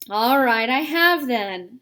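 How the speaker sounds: put-on loud, miserable outburst from an upset boy; a boy who no longer cares, speaking up loudly. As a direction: blurt it out loud and defiant. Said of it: "said Diggory in a much louder voice".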